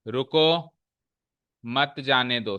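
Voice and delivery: रुको set apart, long pause, then मत जाने दो together; pause after रुको. Shown as a demonstration. Teacher quotes a familiar Hindi sentence, pausing after रुको. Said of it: "एक हिंदी की बहुत ही एक प्रचलित सेंटेंस है".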